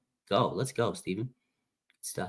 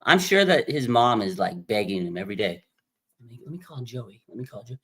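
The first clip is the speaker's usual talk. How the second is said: normal talking voice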